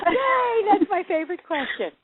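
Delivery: high-pitched gleeful cheer; real delight. Real, not acted. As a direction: burst out happily, pitch leaping up. Delighted at the interviewer's question.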